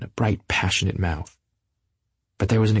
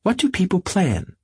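helpless; 12 semitones higher than usual. Acted. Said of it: "She turned to me helplessly".

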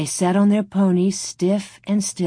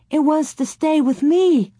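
put-on high-pitched child's plea; begging; 9 semitones higher, 3 dB louder than usual. A narrator's reading. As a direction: insist in a small girl's begging whine. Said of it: "Laura begged".

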